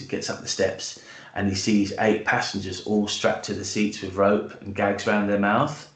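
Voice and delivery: ordinary talk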